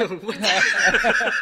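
disgusted groan. Real, not acted. Disgusted reaction to creepy comment.

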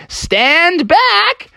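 high, commanding character call; weasel warning. Acted. Weasel janitor warns Froggy before swinging the axe, high call. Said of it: "he was like".

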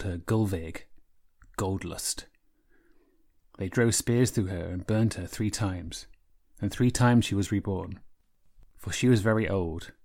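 calm, measured storytelling voice; epic fantasy narrator. A narrator's reading. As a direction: tell it like an old Norse saga. Author reads from her novel.

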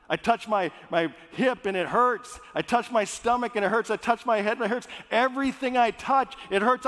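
panicked. Acted. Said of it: "he's just panicked".

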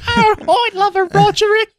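high squeaky woman's voice; high falsetto lady. Pretend. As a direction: play the swooning lady way up high. Playing the flattered English woman.

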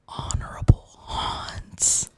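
slow, spooky stage whisper; hushed segment title. Pretend. Host introduces the haunts segment, slow theatrical whisper. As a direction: whisper it slowly, drawing it out.

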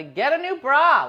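playful shout of advice; mock yell. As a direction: yell it across the room in jest. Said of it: "Did you yell somebody".

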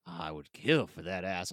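very low mock Texan-Russian accent; hammed-up low villain, Texan-Russian accent; Peter Stormare. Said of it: "Peter. Whatever you wanna say".

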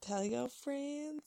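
high, soft, drawn-out echo; playful echo. Pretend. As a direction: echo the plug lightly, high and slow. Echoes co-host's sign-off.

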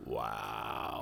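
low, quiet disbelieving wow; stunned wow. Retold or imitated. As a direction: let it fall flat in disbelief. Stunned reaction to insult.

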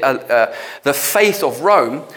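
normal talking voice

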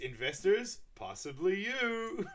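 high, loud pitch slowing to a drawn-out finish; showy mock sales pitch; imitated presenter. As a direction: tease the audience like a hammy presenter. Acting out a business presentation.

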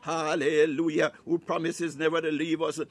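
usual speaking voice

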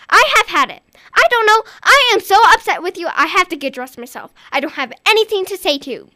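loud, high, fed-up scolding; angry mother. Acted. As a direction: lay into the child. Mother scolding, says so upset.

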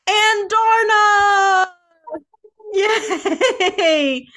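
shouts the winner's name high and long; game-show reveal of the winner, drawn out and loud; excited shout. Real, not acted. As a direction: announce the winner with a big cheer. Announcing award winner.

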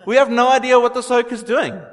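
loud, very high-pitched incredulous jeering; hammed-up mockery in a high, loud voice; mocking scoffer. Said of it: "were mocking at him, they were scoffing".

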